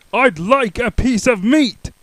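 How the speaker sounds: loud, slow high boom. Acted. Mister Noisy ordering meat from the butcher, booming. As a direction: boom it out slowly. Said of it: "boomed Mister Noisy".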